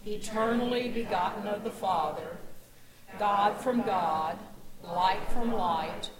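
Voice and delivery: reciting the creed. Acted. Pastor leading the congregation in the creed, loud and steady. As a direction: recite it firmly with the congregation, slowing at the end.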